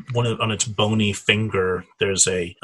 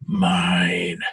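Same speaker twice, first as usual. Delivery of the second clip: low hissing "Mine" from a lurking creature; hissed creature line; 4 semitones lower than usual. Acted. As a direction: hiss the word out, possessive. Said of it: "he just hisses even more emphatically".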